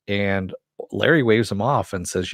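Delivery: usual speaking voice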